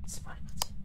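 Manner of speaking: hushed whisper while hiding. Real, not acted. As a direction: whisper so the ghost can't hear. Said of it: "il faut tempo".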